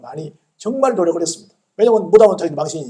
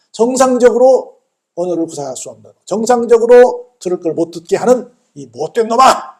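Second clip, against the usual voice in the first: loud, raised rebuke in imitation; scolding command; 4 semitones higher, 6 dB louder than usual. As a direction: berate the spirit, building to the final insult. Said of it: "호통을 치시면서 명령하시니까". (호통치는 흉내, 높고 큰 목소리)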